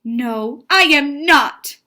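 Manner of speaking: emphatic read-aloud exclamation; excited exclamation. Shown as a demonstration. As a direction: exclaim it indignantly as a story character. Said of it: "That's why I sounded excited".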